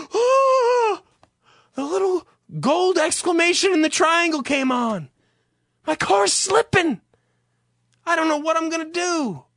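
high-pitched, hammed-up panic; mock freak-out. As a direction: wail like a helpless panicking driver. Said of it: "and you freak out".

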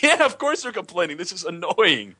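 fed-up incredulous comeback; annoyed. Retold or imitated. Annoyed retort to hygienist.

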